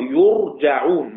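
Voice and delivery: يرجعون as "yur-ja-'ūn", slow, u then a; yurja'ūn, passive form. Shown as a demonstration. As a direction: say يرجعون with damma on the ya, fatha on the jim. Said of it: "مبني للمجهول".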